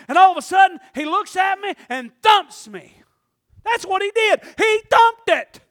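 slow, loud, high exultant preaching; fiery preaching. Acted. Preacher praising God to the church, fiery and loud. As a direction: preach it out with full force.

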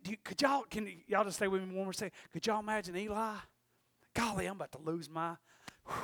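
very soft, subdued delivery; hushed preaching. Real, not acted. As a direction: speak quietly, as if overcome.

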